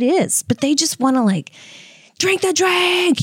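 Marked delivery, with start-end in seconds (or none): 2.2-3.2 high, drawn-out pushy yell; mock yell. Men pushing a drink on a young comic, mock yell. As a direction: draw it out high, imitating a heckler. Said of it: "getting, yelling at me".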